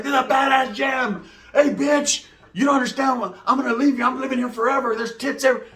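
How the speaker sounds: high-pitched mock yelling; top-of-lungs arguing. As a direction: imitate an uncle hollering at his girlfriend on the phone. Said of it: "arguing at the top of his lungs".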